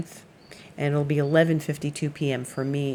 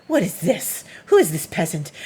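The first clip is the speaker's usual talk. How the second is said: snooty cat voice. Pretend. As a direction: voice the offended cat looking down on a peasant. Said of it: "She's just like".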